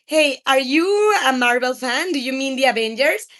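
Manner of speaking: normal talking voice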